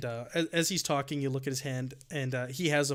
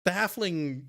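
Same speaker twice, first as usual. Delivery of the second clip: normal talking voice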